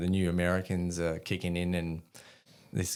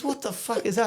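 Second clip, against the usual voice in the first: baffled. Retold or imitated. Colonel's baffled question.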